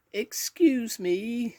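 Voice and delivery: tiny, very high, slow voice; very small voice. A narrator's reading. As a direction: squeak it slowly, timidly. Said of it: "in a very small voice".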